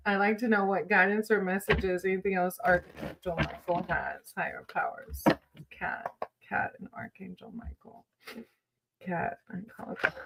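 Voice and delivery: hushed, soft calling on the archangel; whispered invocation. Acted. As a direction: murmur the summons like a quiet prayer. Whispered invocation over tarot cards.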